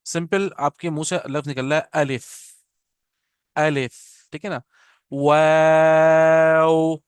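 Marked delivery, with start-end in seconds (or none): 5.1-7.0 वाव held very long and louder, lips round then spread; drawn-out waw. Shown as a demonstration. Teacher showing lip movement for Arabic waw, long loud वाव. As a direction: sustain वाव slowly with rounded lips opening. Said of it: "हम अपने होंठ गोल करें और उसके बाद फिर उसको फैलाएं".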